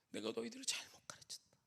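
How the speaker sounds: soft whisper, 너희들을 rising, broken teacher; tearful whispered lament. Retold or imitated. Teacher whispering regret to students, soft tearful whisper. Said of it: "막 우시는 거예요".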